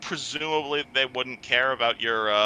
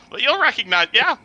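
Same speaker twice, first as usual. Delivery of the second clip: high, loud officer's voice; lightly voiced woman officer; police officer voice; 12 semitones higher, 5 dB louder than usual. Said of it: "police officer's voice".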